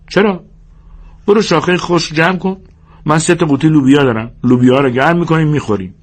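high-pitched brisk-minded orders; George, ordering. Acted. George sends Lennie for wood before supper, bossy.

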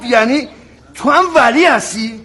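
can't believe it's another Vali; incredulous outburst. Acted. Officer gasping in disbelief at another Vali.

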